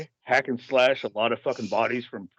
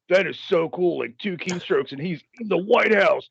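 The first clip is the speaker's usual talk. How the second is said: starts high and ends in a much higher yelp of delight; dumb happy excitement; 8 semitones higher than usual. Retold or imitated. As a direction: pitch climbing higher until a soaring Fuck yeah. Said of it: "dumb, and happy and go".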